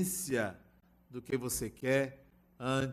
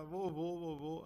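lightly voiced crying grandson, soft and higher; mock child's crying; 3 semitones higher, 7 dB quieter than usual. Grandson crying for grandpa to pick him up, mild mock crying. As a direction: plead softly like a tearful little boy. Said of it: "Começou a chorar, chorar, chorar".

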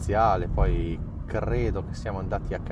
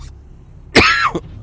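a real cough; sharp cough mid-sentence; coughing fit; 10 dB louder than usual. Real, not acted. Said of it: "A parte sta tosse".

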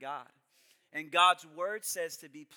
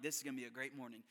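quick quiet self-talk; 4 semitones lower, 7 dB quieter, faster than usual. Retold or imitated. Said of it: "I'm like".

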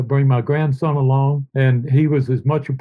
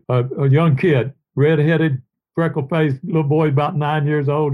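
ordinary talk

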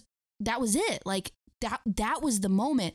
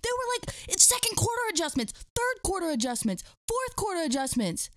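high-pitched, incredulous listing; theatrically baffled, pitched way up; mock disbelief; 10 semitones higher than usual. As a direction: play amazed at an unheard-of thing. Mock disbelief at coaching adjustments.

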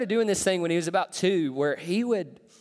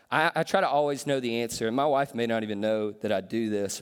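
usual speaking voice

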